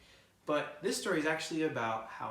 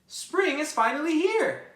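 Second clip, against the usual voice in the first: very high, slow, louder cheer; excited mouse; 14 semitones higher, 12 dB louder, slower than usual. Acted. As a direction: savor the news, tiny and thrilled.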